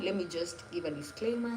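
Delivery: ordinary delivery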